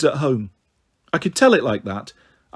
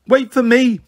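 put-on high, louder child's call; 8 semitones higher, 5 dB louder than usual. A narrator's reading. Small girl calling to be taken along, calling out. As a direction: call after them to wait, pitched high. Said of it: "Pixie called".

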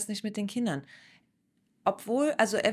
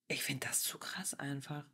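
whispered, barely voiced reaction; hushed disbelief; 6 semitones lower, 10 dB quieter than usual. Real, not acted. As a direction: say it under your breath, stunned. Stunned reaction to the clip.

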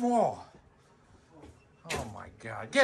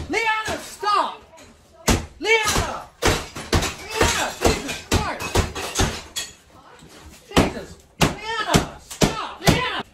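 exasperated yelling; 7 semitones higher, slower than usual. Real, not acted. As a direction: yell the name to make them stop. Parent yelling at child to stop.

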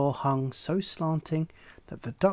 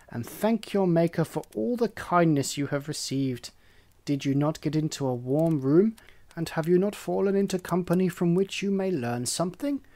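louder, lecturing hen voice; preachy hen; 3 semitones higher, 4 dB louder than usual. Acted. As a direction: talk down with false kindness.

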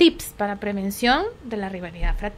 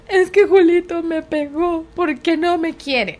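put-on higher, louder, slow child's whine; whining child; 5 semitones higher, 6 dB louder, slower than usual. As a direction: sulk like a hurt kid. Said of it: "niño se queja". (niño quejándose, más agudo y lento)

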